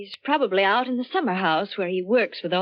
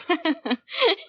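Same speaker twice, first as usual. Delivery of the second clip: nervous laugh; 8 semitones higher than usual. Acted. Laughs at the shot.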